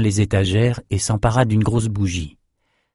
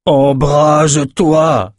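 loud, high, very drawn-out cry; shouted command; 6 semitones higher, 5 dB louder, much slower than usual. A narrator's reading. Nathan retries the failed spell on the candle, long loud shout. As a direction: cry out the spell, stretching every syllable. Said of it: "cria Nathan".

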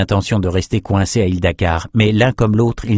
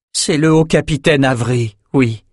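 higher, pained confirmation; soupir accablé; 6 semitones higher than usual. A narrator's reading. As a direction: confirm it with mild distress. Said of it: "soupira le premier garde". (confirmation peinée, voix plus haute)

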